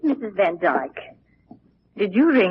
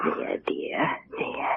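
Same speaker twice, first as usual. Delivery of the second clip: softer, breathy hush; quiet her gently like a child; soothing whisper; 8 dB quieter, slower than usual. Acted. Maid soothing the weeping girl, soft whispered comfort.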